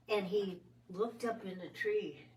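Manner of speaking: normal talking voice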